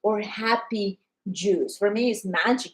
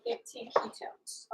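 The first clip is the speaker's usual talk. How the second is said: breathy whisper, much softer; whispered count; 13 dB quieter than usual. Real, not acted. Seller counting ketones aloud, in a whisper. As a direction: whisper the number to yourself.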